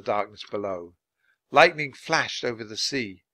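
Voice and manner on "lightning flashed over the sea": plain reading voice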